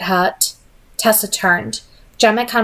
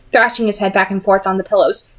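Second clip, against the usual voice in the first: ordinary delivery, nothing marked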